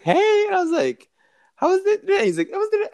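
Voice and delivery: very high mock-sweet voices; high-pitched gossip impression; squeaky retold chat. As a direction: chirp the quotes up high.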